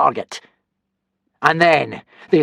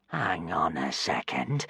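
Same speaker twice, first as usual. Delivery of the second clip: soft, low, slow, wary; low suspicious; 8 semitones lower, 9 dB quieter, slower than usual. A narrator's reading. Alvin noticing the shirt, quietly wary. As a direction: hold it back suspiciously. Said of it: "said Alvin".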